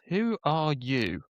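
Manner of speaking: put-on drawn-out cooing, as if to a baby. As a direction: imitate a dad cooing slowly at his baby. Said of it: "when people do the baby voice".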